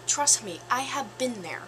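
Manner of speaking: normal talking voice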